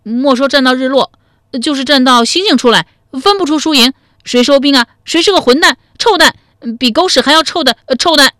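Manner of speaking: put-on high, loud childish piping voice; cheeky kid talking big in a baby voice; babyish little boy. A narrator's reading. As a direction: play a small boy, high and forceful. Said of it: "奶声奶气地说".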